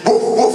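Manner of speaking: mock dog bark woof woof; imitated barking. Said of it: "He says".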